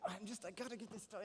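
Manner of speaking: soft, harried mutter of a busy mother; a lightly voiced stressed mom; mild frustrated mom. Mother sweating in the kitchen, softly frustrated. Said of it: "she's frustrated at this point".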